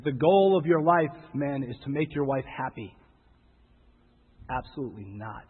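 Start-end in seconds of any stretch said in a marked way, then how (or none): 4.5-5.4 low firm correction. Acted.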